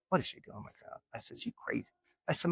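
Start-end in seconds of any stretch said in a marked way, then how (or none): 0.1-1.0 unvoiced whisper about mom; whispered aside. A whisper to granny about mom. 1.4-1.9 unvoiced whisper about mom; whispered aside. A whisper to granny about mom.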